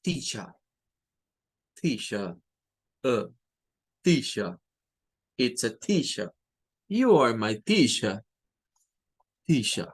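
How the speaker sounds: British accent: "teacher" ends on an open a, no r; British accent, non-rhotic "teacher". Shown as a demonstration. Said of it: "Escuchemos a un británico decir esto".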